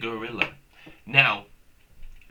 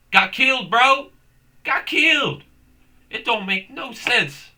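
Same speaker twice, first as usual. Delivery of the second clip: outraged shout; 9 semitones higher, 6 dB louder than usual. Real, not acted. Outraged rant about brain rot.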